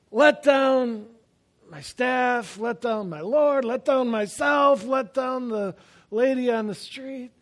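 preacher's lament; building lament. Real, not acted. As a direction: pile up the failures with rising weight. Sermon climax listing failures.

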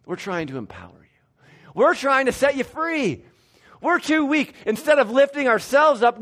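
high, loud mock-humble sarcasm; sarcastic Paul. Preacher voicing Paul's sarcastic reply to the Corinthians, high and loud. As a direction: lay the irony on thick. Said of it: "Paul's weakness has been the issue".

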